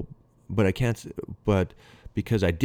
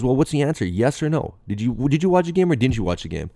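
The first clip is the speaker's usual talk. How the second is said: higher-pitched retold interrogation; insistent questioning; 6 semitones higher, 4 dB louder than usual. Manager demanding a yes or no from his player, insistent retold questioning. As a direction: press for a straight answer, imitating the boss.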